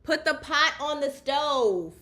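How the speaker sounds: real yelled household instruction; calling out. Real, not acted. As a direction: holler directions so they carry through the house. Shouts instructions to someone off mic.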